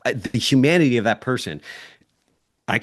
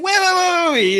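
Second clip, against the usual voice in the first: high, louder exasperated "wait" repeated; exasperated interruption; 5 dB louder than usual. Retold or imitated. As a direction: cut them off mid-sentence in disbelief. Retold argument, interrupting exasperatedly.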